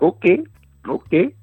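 a caught-off-guard okay, okay; surprised okay. Retold or imitated. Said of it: "I was like".

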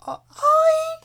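very high, loud squeal; silly put-on voice. Co-host trying out a voice, very high. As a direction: search for the voice in high wavering squeaks. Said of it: "this is a voice".

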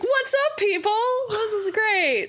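very high-pitched fake hype; mock enthusiasm. Guest reacting to her intro, mock overexcited. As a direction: squeal a drawn-out, overexcited podcast greeting. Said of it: "make me wanna be like".

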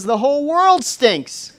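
high, loud and slow "The whole world stinks"; hammed-up disgust. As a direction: stretch out the disgust for the punchline. Camper's punchline.

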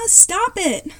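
high-pitched, restrained mock scream. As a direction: cry out high, as in a sibling fight. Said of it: "Then a voice screamed".

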